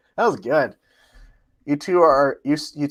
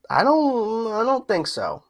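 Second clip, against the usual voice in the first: usual speaking voice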